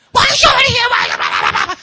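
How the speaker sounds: high-pitched frantic mock shriek; screaming parent. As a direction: scream wildly at the kids. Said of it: "They scream all the time".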